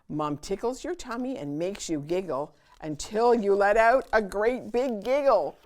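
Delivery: ordinary delivery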